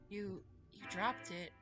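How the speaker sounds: ordinary delivery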